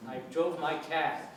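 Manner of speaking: Boston accent, car without its R; teacher imitating a Boston accent. Shown as a demonstration. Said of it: "they'd say cat".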